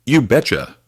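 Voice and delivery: you betcha, Minnesota-style, high and loud in horror; horrified Minnesota accent. Acted. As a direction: blurt it in Minnesota accent, appalled at yourself. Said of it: "she said, horrified".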